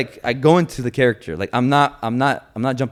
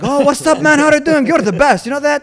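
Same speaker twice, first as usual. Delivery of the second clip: higher, louder and gushing; over-friendly excited greeting; 13 semitones higher, 5 dB louder than usual. Retold or imitated. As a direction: hype up a stranger. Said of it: "Yeah, like".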